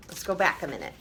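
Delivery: ordinary delivery, nothing marked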